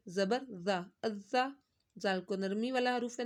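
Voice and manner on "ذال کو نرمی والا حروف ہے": ordinary talk, nothing marked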